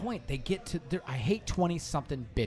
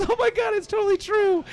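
very high, loud mock-girly squeal; imitated hysterical girl; 7 dB louder than usual. Girl dying laughing at the elbow joke, mock hysterics. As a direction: mimic a girl cracking up as she squeals. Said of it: "just started dying laughing".

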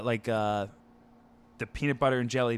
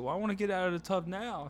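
put-on higher, softer sulking child; imitated sulky daughter; 6 semitones higher, 5 dB quieter than usual. Daughter wanting out of the tub, imitated sulk. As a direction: mimic a hurt kid, quietly. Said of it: "she's like".

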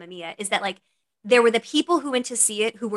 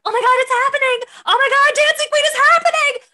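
excited fan; 7 dB louder than usual. Retold or imitated. Fan reacting to favourite song.

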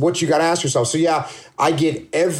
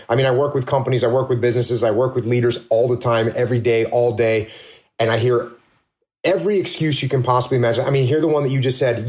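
ordinary delivery

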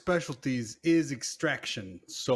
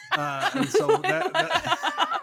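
high, loud laughter breaking through words; can't get the words out for laughing; 5 dB louder, much slower than usual. Real, not acted. Laughing at own plan.

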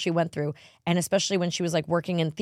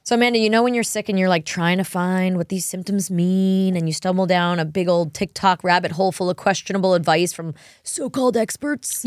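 louder, upbeat sponsor pitch; ad read; 2 semitones higher, 6 dB louder than usual. Acted. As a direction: sell it like a podcast ad.

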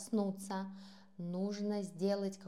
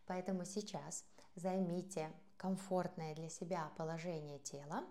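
ordinary delivery

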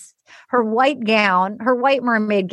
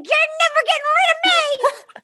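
very high-pitched put-on squeal; mock squeal. [name] clings to Dale, mock high squeal.

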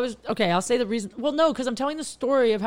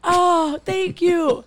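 high, loud, laughing thanks; giddy, relieved outburst; delighted gratitude; 8 semitones higher, 7 dB louder, much slower than usual. Real, not acted. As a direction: thank them as if they saved the story. Delighted at being reminded of story.

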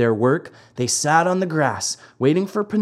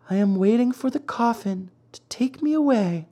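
weak fairy voice; 9 semitones higher than usual. A narrator's reading. Fairy answers Pinocchio.

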